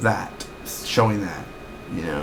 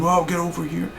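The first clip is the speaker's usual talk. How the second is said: lightly imitated angry parent calling; mock yelling adult; 7 semitones higher than usual. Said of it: "What's up with the yelling?".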